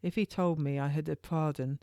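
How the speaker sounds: plain reading voice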